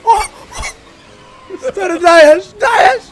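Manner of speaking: high, loud screaming cheers; excited shouting. Real, not acted. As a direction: yell in wild excitement. Cheering during a jump.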